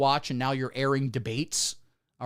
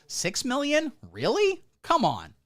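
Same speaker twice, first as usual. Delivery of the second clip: mock disbelief; 10 semitones higher, slower than usual. Mock incredulity about numbers.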